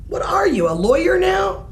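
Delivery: high incredulous shout; mother baffled by her haggling son; exasperated. Acted. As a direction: throw the question up in disbelief. Mother's exasperated retort to bargaining.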